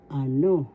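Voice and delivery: Ano with a loud "no"; stress on second syllable. Shown as a demonstration. Teacher demonstrating the accent of the word, stress on second syllable. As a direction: push the last syllable of Ano. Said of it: "The second syllable has the accent".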